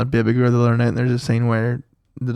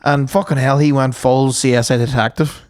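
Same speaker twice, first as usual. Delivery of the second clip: normal talking voice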